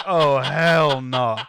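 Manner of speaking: drawn-out loud alarmed yell; shocked yell. Retold or imitated. Seeing a guy punch himself, a slow loud yell. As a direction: recoil and yell it slowly.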